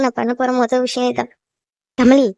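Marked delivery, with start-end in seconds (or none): 2.0-2.3 a loud call of Kamali, falling at the end. Acted. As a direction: call Kamali from across the room. Mother calls daughter by name. (மகளை உரக்க அழைக்கும் அம்மா, கமலி)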